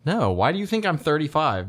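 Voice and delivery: usual speaking voice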